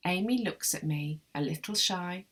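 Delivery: ordinary delivery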